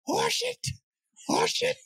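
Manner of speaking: high squeaky bug chatter; high insect voice. Acted. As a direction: echo it like a tiny bug. Insects echoing.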